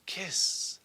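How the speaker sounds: soft, breathed-out word; hushed kiss. Acted. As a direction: let it out almost as a whisper. Kisses punctuating a love scene.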